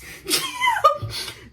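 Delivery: high, squealing laugh. Real, not acted. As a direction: burst into a high-pitched cackle. Laughs at absurd hack claim.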